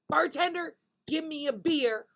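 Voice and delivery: demanding bear. Acted. Joke's bear orders the bartender after being refused, demanding.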